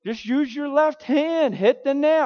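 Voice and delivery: high, loud, slow scolding; laying into him. Retold or imitated. Great uncle scolding him over the nail, angry and loud. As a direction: bark it at the clumsy nephew. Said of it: "Man, did he lay into me".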